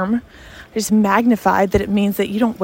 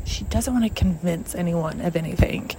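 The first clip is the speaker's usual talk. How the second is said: quiet inner voice; 4 semitones lower, 8 dB quieter than usual. Retold or imitated. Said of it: "I was like".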